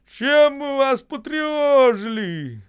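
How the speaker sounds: high, loud, drawn-out cry; indignant shout. A narrator's reading. Боев changing footwear, shouting back. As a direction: shout it slowly, high-pitched. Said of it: "Боев, переобуваясь, кричит".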